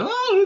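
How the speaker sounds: high-pitched mock wail; exaggerated high cry of horror. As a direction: wail as if reliving a terrible listen. Acts out reaction to hearing album.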